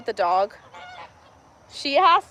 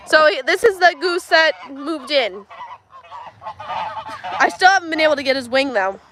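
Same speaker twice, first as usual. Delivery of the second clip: ordinary talk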